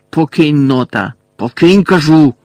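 loud, forceful growled command; snarling order. A narrator's reading. Malfoy ordering his men to leave Nott, loud snarl. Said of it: "почув Мелфоєве гарчання".

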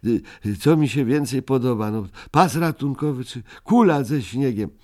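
a touch of despair, lightly voiced; torn Ryjek. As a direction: dither anxiously between the two. Ryjek torn between two treasures.

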